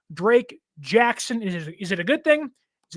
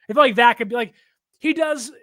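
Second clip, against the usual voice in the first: ordinary delivery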